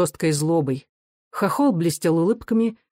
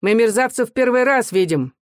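higher-pitched, needling retort; pitched up to grate on the listener; irritating jeer; 6 semitones higher, 3 dB louder than usual. A narrator's reading. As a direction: provoke them with an irritating, raised voice. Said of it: "раздражающий голос Николая".

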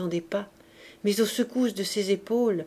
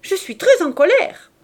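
high, loud petulant shout; angry cry; 10 semitones higher, 9 dB louder than usual. A narrator's reading. Cosette shouting back through the door, angry cry. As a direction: cry it out in a mock-tragic huff. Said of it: "et elle leur cria".

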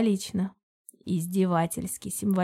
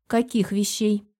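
usual speaking voice

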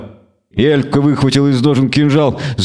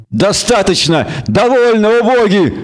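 higher, nervous cry; Dandelion shouting nervously while bound; 8 semitones higher than usual. A narrator's reading. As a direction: call out in panic, tied up. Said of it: "нервно крикнул Лютик".

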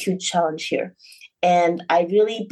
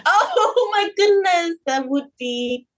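laughing; 11 semitones higher, slower than usual. Real, not acted. Laughing at the pastry shop pun.

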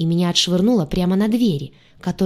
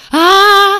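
long, high, loud rising scream; panicked howl; 13 semitones higher, 12 dB louder than usual. A narrator's reading. Others join the panic, high howling scream. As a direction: howl out a drawn "aaah". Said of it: "взвыли на все голоса остальные".

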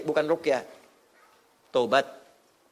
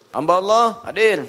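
calling out; 3 semitones higher, 5 dB louder than usual. Pretend. Mock roll call for questioner.

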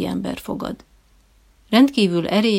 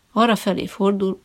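plain reading voice

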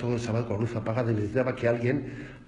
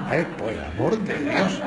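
laughing through "por el amor de Dios", louder; laughing exasperation; 3 dB louder than usual. Retold or imitated. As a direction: exclaim in disbelief while still laughing. (exclamación incrédula entre risas)

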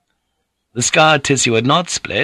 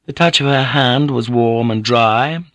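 plain reading voice

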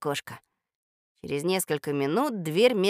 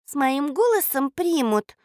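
unsure; 7 semitones higher than usual. Acted. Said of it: "неуверенно сказала Катя".

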